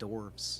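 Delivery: dwarves said quietly as "dorvz"; silent-W dwarves. Shown as a demonstration. Friend sticking to his version, dwarves as quiet dorvz. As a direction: say "dwarves" low, no w, with v. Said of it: "I think it's dwarves".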